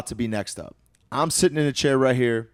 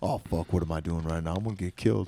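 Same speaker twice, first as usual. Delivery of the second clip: panicked inner doubt; 3 semitones lower than usual. Retold or imitated. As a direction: mutter your fear to yourself.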